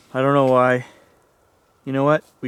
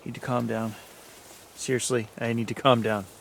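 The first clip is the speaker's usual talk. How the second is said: much softer, lower murmur to herself; a scared half-breath telling yourself to settle; hushed self-calming; 5 semitones lower, 11 dB quieter than usual. Real, not acted. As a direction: quietly talk yourself down. Alone, scared, talking self down.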